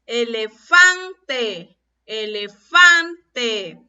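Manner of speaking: "Elefante" drawn out, loud on FAN; very slow e-le-FAN-te, punching "fan"; stressed tonic syllable. Shown as a demonstration. Teacher shows which sounds stronger, "Elefante" with loud stressed "fan". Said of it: "La que suena más fuerte".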